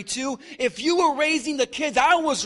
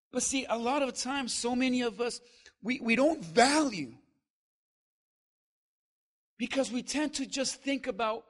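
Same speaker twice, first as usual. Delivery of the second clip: ordinary delivery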